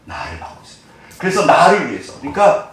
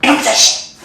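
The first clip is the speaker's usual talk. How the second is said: angry scold; 10 semitones higher, 4 dB louder than usual. Acted. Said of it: "하나님 절대 그러지 않으세요".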